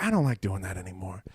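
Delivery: put-on low, deflated child's refusal; mock unwillingness. Kids to dad about dog chores, unwilling. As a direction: drop low, all enthusiasm gone.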